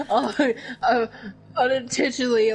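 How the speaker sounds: high laughing, words breaking up. Real, not acted. Laughing while talking.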